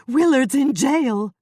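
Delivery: high, loud, slow distressed cry; cried out. Acted. Old woman bursting in to tell Jane, crying out high and loud. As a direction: burst in crying out the bad news. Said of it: "she cried".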